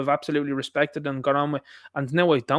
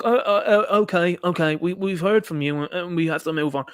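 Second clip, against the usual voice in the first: flustered placating imitation; 2 semitones higher than usual. Imitating officials hurrying a questioner along.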